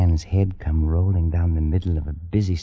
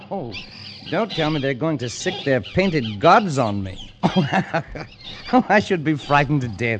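laughing, higher scornful reply; mocking laughter; 5 semitones higher than usual. Pollock laughing off the curse to Waterhouse. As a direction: laugh off the threat with contempt.

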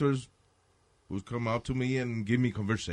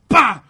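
thud sound; 8 semitones higher, 14 dB louder than usual. Retold or imitated. Cop lands from ceiling.

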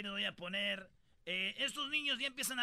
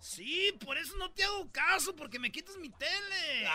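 put-on kid whining; 6 semitones higher than usual. Kid complains.

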